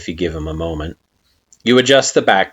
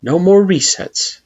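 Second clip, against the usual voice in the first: solemn echo; 10 semitones higher, 4 dB louder, slower than usual. A narrator's reading. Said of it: "you echo in affirmation".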